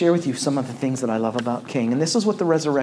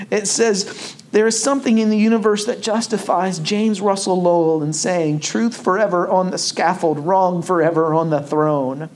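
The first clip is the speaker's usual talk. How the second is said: loud, high ringing sermon climax; full preaching; 6 semitones higher, 4 dB louder than usual. Acted. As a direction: preach it to the rafters.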